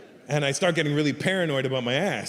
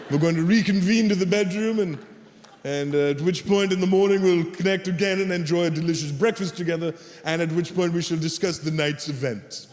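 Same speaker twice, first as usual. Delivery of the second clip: formal host voice. Acted. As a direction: play the polite dinner host wrapping up. Formal host closing the evening.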